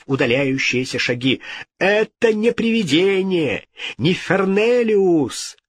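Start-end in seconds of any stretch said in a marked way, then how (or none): 1.8-5.6 high-pitched emphatic denial; indignant exclamation. A narrator's reading. King seeing through the hoax, indignant. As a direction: exclaim high, stretching the name.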